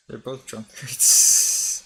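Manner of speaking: put-on loud, slow drunken-style call; loud drawl. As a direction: bellow it slowly. Drawn-out loud joke line.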